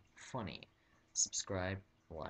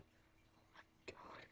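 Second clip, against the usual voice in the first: frightened unvoiced whisper; scared whisper; 4 semitones higher, 4 dB quieter than usual. Shoppers whispering fearfully about the hooded guy. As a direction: hiss it like terrified shoppers. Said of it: "the people in the store were like".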